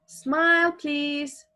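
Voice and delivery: high, loud cue; calling out. Acted. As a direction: call the cue brightly. Acting out a photographer's command.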